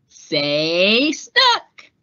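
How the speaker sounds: lightly voiced photographer. Photographer asking for a smile, playful. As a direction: coax a smile from the sitter.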